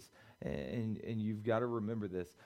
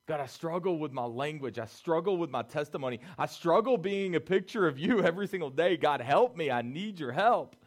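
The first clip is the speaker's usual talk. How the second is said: louder, building plea to God; pleading prayer; 5 semitones higher, 11 dB louder than usual. Retold or imitated. Preacher voicing a struggling believer begging God for help. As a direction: cry out for help, growing. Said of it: "That's what Samson's saying".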